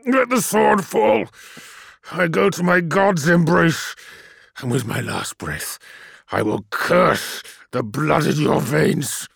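high, loud shouted curse; dying captive shouting a curse; defiant dying curse. A narrator's reading. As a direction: curse him with your last breath.

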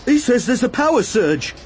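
high, urgent villager's cry; alarmed shout. A narrator's reading. Villager pulling free of Arad, alarmed shouting. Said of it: "one of the villagers shouted".

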